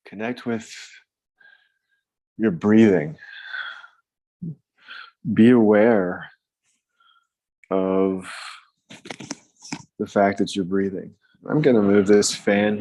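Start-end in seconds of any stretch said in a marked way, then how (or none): 0.1-11.1 calm meditation voice; guided meditation. Acted. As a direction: ease them gently into the breath. Leads a breathing meditation.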